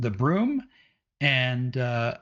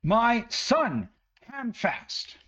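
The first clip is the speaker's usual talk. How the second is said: much higher, ranting village mother; angry mother; 12 semitones higher than usual. Acted. Mother rages at the party over her lost son, much higher. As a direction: rant at the adventurers, shrill with worry.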